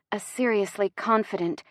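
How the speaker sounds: ordinary delivery, nothing marked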